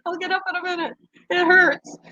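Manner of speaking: high whiny plea; mock whimper. Streamer quoting herself after the fall, whiny and hurt.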